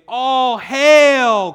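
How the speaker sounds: high, loud jeering cry of hail; loud sneering mockery of a false king; mocking soldiers' shout. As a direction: hail him with scorn, full voice. Soldiers mock Jesus as king.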